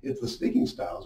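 ordinary talk

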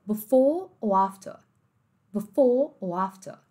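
slow "Before or after" with no Rs; British dropped Rs. Shown as a demonstration. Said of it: "não ouviu o R".

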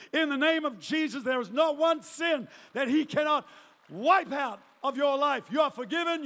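loud, driving declaration; full-force preaching. Real, not acted. Preacher declares forgiveness to the room, loud and impassioned. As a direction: proclaim it forcefully over the crowd.

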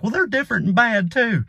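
high, hammed-up dim yokel voice; mock right-winger. Mocking right-wingers learning the boat is from Singapore, high yokel whine. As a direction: whine it like a cornered bigot.

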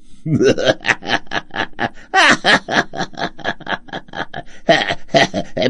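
evil cackle, trying his best; hammy old villain laugh. Acted. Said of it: "dar uma risada de vilão".

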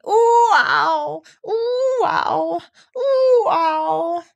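loud seesawing squeals; bouncing cries. A narrator's reading. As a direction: yelp up and down with each bounce. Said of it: "And he was saying".

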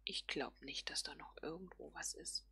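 ordinary talk

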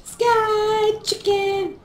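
high, slow, stretched-out call; playful high cry. Pretend. Player repeats the sky chicken joke, playful high cry. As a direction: draw it out high and silly.

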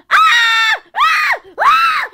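short, very high screams; panicked scream. Acted. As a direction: shriek in repeated bursts. Screaming in acted emergency.

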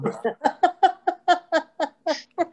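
bright high laugh; real laugh. Real, not acted. Teacher laughing at a student's eagerness, real laugh.

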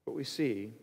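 ordinary talk

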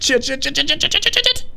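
high frantic swearing; panicked yelping. Real, not acted. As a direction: yelp each curse as panic spikes. Panic rising.

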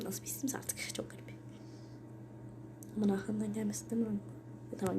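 hushed whisper while hiding. Real, not acted. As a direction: breathe it out unvoiced. Whispered asides during hide. (fısıltıyla)

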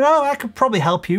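laid-back offer of help; conversational. Shown as a demonstration. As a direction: say it lightly, no pressure. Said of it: "even the way you say this, tonality-wise".

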